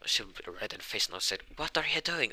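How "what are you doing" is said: flustered protest. Acted. Flustered, red-faced after sudden kiss.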